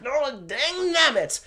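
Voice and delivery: hammed-up curse at the phone; a mock outburst of frustration; mock angry swearing. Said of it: "he screams and swears and he's angry".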